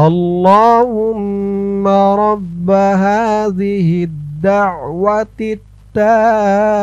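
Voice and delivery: slow melodic Arabic recitation. Shown as a demonstration. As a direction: chant the phrase slowly and sweetly. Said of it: "আমার সাথে বলুন". (ধীরে সুরেলা আরবি তিলাওয়াত)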